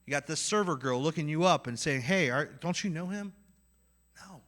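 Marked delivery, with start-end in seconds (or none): none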